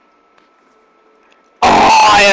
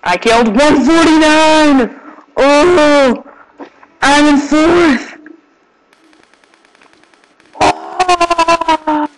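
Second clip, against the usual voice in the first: excited; 8 semitones higher, much slower than usual. Real, not acted. Excited shout after a kill.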